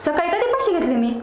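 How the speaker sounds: loud, indignant retort; indignant shout back. Acted. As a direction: fire it back at the husband. Wife shouts back at husband.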